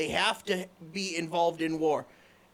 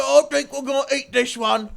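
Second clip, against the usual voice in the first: lightly voiced hungry orc, a bit louder; gleeful orc; 8 semitones higher, 9 dB louder than usual. Storyteller voicing an orc about to eat someone, mock menace. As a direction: toy with the victim, hungry brute. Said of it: "they go".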